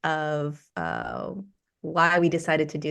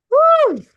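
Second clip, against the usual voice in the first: high, loud "woo"; excited whoop; 11 dB louder than usual. Real, not acted. Host cheers as people join the live meditation, excited whoop. Greeting viewers as live starts.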